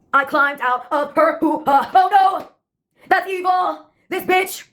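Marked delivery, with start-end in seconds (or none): 1.9-4.7 put-on high-pitched theater-kid outburst; theatrical scream. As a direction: play it like a melodramatic musical chorus. Recurring theater-kid chorus.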